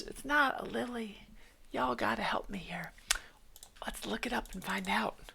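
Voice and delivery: unvoiced, soft whisper. Real, not acted. Asking the chat for help in a soft whisper.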